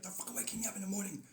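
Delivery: mock irritated outburst; grumpy Holy Spirit. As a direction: complain like you've just been woken. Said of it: "who's not a morning person".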